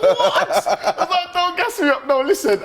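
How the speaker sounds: laughing disbelief. Retold or imitated. Retold flustered reaction, laughing.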